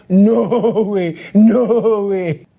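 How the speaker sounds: quoting with a laugh; amused mock protest; laughing quote. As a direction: laugh through the no way. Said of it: "the people say".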